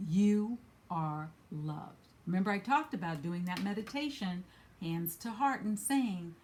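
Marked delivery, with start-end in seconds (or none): 0.1-2.1 slow, drawn out. Retold or imitated. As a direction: stretch every word of You are loved very slowly. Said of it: "Archangel Michael is saying".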